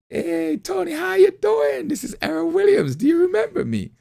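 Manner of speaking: very high, loud American phone greeting; put-on American accent. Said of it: "put on my American, my American accent".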